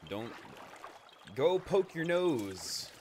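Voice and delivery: high, slow, loud mock echo; mock Korok echo. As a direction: stretch it out high and loud, teasing. Mocking the Korok line.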